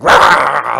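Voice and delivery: loud mock dog "rah"; imitated dog snarl. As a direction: growl like a dog warning off a puppy. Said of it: "Minnie's like".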